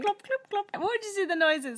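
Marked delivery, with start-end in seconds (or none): 0.0-0.7 high-pitched clip-clop imitation; imitated horse clops. Imitating a horse's hooves for a friend, playful high clops. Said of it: "That sounded like a horse".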